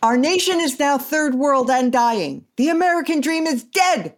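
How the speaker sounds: hammed-up all-caps rant; shouting capitalized words. Shown as a demonstration. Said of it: "I should shout this because it's in all caps".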